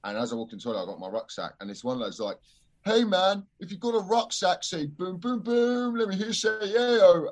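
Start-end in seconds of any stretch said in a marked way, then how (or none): 2.8-7.3 high, chanting heckler impression; imitating a rowdy lad. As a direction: mimic a lad hyping up a crowd. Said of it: "in that exact kind of tone".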